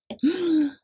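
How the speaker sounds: high gasp of shock; sharp gasp. A narrator's reading. Goliath is struck in the head, gasping. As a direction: gasp as the stone hits.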